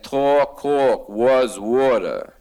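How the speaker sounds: mock New York vowels on "Talk, cork, was water"; drawn-out New York accent, slow on "water"; lightly imitated New York accent. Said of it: "I recognize that accent".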